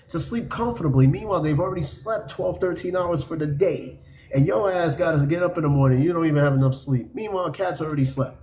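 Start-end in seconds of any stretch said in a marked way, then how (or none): none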